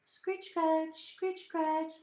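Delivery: high, silly scratching chant; playful pet talk. Real, not acted. Owner scratching her dog, playful pet talk.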